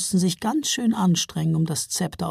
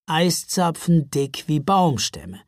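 plain reading voice

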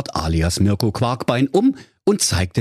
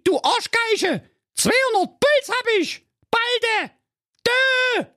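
very high, furious ranting; raging Jacqueline. Acted. Jacqueline raging at Mirko over the shitstorm, shrill fury. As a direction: rant at him in a shrill fury. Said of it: "Und die Jacqueline tobte".

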